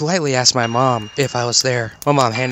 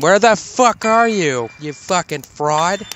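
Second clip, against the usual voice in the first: higher, slow yell into the dark; retold scream; 6 semitones higher, slower than usual. Screaming at a hidden stranger in the rainy dark, higher and slow. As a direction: call out angrily into the rain at a stranger. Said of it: "And I screamed".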